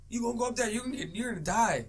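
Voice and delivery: put-on kid's warning.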